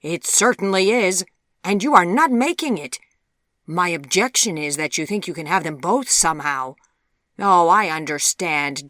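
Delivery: loud, forceful dressing-down; angry rebuke. A narrator's reading. Mother shaming her daughter for using a suitor, angry. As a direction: berate her as an outraged mother.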